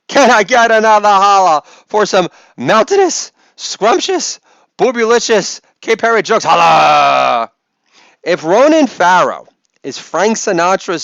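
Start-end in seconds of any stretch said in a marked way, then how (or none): 0.1-6.4 higher-pitched hype-man holler; calling out. Pretend. Catchphrase call to the crowd. 6.4-7.5 loud drawn-out holler; calling out. Pretend. Catchphrase call to the crowd.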